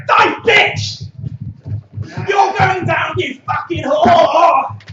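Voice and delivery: high, loud raging yell; screaming at the telly. Retold or imitated. Raging at a female Wii boxer, loud high scream. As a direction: scream it at the screen, last words dragged out slow. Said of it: "I'm screaming at my telly".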